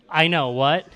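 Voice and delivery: usual speaking voice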